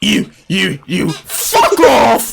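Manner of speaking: mock dismissal building to a shout; high, long-drawn final yell; Gordon Ramsay. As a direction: pick them off, then explode. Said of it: "he just like".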